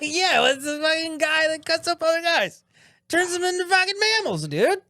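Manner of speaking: squeaky high-pitched voice. Acted. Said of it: "held some pretty good restraint with the voice changey stuff".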